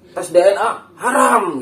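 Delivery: high, loud, drawn-out HARAM; mock fiery preacher. Host shows friends how habaib preach, mock high-pitched shout. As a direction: imitate a preacher thundering the last word, half laughing. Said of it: "ciri khas habaib kalau ceramah begitu".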